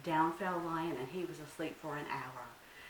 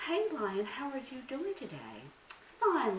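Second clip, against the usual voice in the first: voiced characters; 7 semitones higher than usual. Anansi greets Lion.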